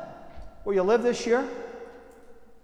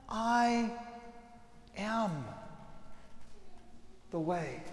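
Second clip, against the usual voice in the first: soft, slowing, falling to near hush; tender; 12 dB quieter, much slower than usual. Acted. Jesus to Thomas and the others, tender and soft. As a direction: offer it gently like open arms. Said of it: "with pure love, looks at Thomas".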